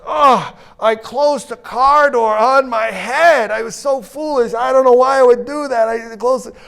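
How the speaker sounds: high, slow, loud self-pitying whine; whiny Bob. Retold or imitated. Bob telling the coffee crowd about his head, in a hammed-up high whine. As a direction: wail it like a sorry fool. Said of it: "And Bob goes".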